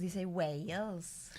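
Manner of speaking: "Wales" stretched into two lilting syllables, Welsh style; mock Welsh voice. Shown as a demonstration. Co-host countering with how people say "Wales", mock Welsh accent. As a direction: drawl "Wales" slowly in a put-on Welsh accent.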